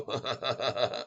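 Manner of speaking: low, quiet staccato laugh; soft chuckle. Real, not acted. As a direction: chuckle softly to yourself. Laughs after teasing a viewer.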